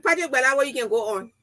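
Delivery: ordinary delivery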